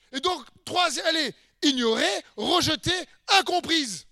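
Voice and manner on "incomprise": ordinary talk, nothing marked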